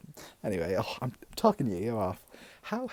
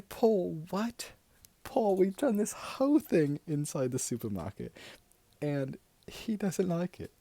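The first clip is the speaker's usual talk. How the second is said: exasperated; 7 semitones higher than usual. Retold or imitated. Telling Paul off over the drink, exasperated. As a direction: protest in raised-pitch disbelief.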